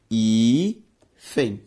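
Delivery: "hífen" split into a long hí, then fen; syllable by syllable. Shown as a demonstration. Teacher showing the word separated, "hífen" syllable by syllable. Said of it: "quando separada".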